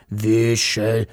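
hissed dragon call. A narrator's reading.

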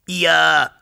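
a high, sobbing little "Ja" from the dragon. A narrator's reading. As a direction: give a small tearful yes as the dragon. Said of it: "schluchzte der Drache".

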